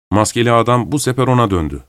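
ordinary delivery

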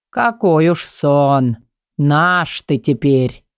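slow, menacing creepy voice; sinister. Acted. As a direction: slowly taunt the boy. Patient taunts boy ominously.

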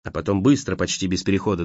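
ordinary delivery, nothing marked

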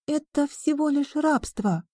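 ordinary delivery

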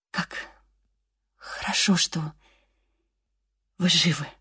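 out of breath, in gasps; breathless. Acted. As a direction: gasp the words out, exhausted. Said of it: "задыхаясь, проговорила она". (запыхавшись, задыхаясь)

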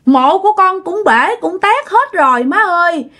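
high, tearful begging; crying plea. A narrator's reading. Trapped daughter cries to mother for rescue, tearful. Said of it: "vừa kêu mà vừa khóc".

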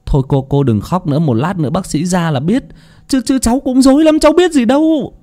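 put-on half-calm, half-rattled young man; consoling nephew. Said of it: "Tùng khuyên".

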